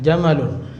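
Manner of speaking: jamalun with a brief "ma"; short vowel. Shown as a demonstration. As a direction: say jamalun without lengthening the "ma". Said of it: "ini pendek".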